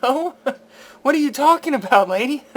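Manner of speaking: mock incredulous retort to the lady; laughing disbelief. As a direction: laugh her theory off in disbelief. Retelling his retort to her.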